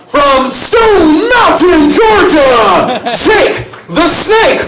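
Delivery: slow, shouted announcer call, stretching the name. Acted. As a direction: bellow the intro like a ring announcer. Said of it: "ring announcer intro".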